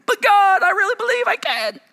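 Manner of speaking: put-on a child insisting to God; mock child pleading.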